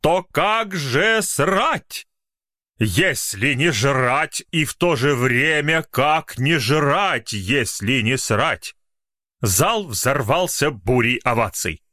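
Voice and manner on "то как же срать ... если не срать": slow, weighty recitation; poet's declamation. Acted. Poet poses his riddle to the hall, slow and weighty. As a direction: spell out the riddle like a great truth. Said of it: "как настоящий поэт".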